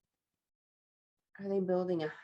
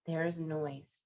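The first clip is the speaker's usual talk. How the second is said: plain reading voice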